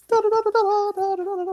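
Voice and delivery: very high, sing-song da-da-da; imitated wobbling noise. Man mimics trying to keep his balance, imitated wobble noise. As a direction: make a high teetering noise as you sway.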